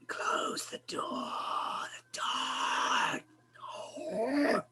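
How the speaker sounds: breathy whispered monster plea, drawn out; creature voice. Acted. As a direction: wheeze the request as the flesh creature. Creature asks from inside the machine.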